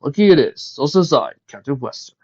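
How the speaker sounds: grand decree, loud and high, then settling; dramatic declaration. A narrator's reading. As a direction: make a captain's pompous proclamation. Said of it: "declared to the committee dramatically".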